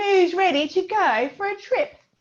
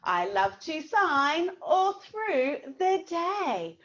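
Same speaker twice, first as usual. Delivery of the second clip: slow spoken song lyrics; much slower than usual. Shown as a demonstration. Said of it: "And it goes".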